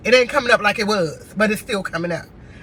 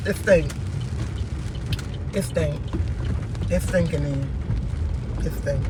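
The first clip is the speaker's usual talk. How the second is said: low, soft, dejected venting; upset; 6 semitones lower, 7 dB quieter than usual. Real, not acted. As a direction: say it quietly, voice sunk low, worn down by it all. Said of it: "I'm upset. I am very upset".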